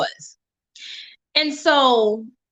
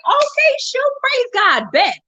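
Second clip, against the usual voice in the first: high, thrilled squeal of agreement; excited yes; 12 semitones higher, slower than usual. Retold or imitated. Accepting her friend's offer to split the cost, thrilled high yes. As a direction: jump at the offer with delight. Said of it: "I was excited".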